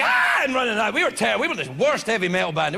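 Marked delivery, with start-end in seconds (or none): 0.0-0.4 shrill high shriek of horror; mock shriek of a fleeing fan; terrified scream. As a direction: shriek and flee from terrible music. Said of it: "You'd be like".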